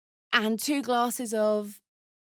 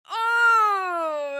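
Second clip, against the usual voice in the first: startled cry; 12 semitones higher, 5 dB louder than usual. Acted. Sudden pain in battle.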